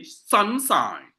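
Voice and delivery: slow "sunshine", SUN and SHINE equally long; both syllables stressed. Shown as a demonstration. Teacher showing all syllables stressed, "sunshine" evenly weighted. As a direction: stretch both halves of "sunshine". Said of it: "All of them are stressed".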